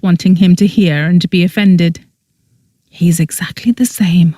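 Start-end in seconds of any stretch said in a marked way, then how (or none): none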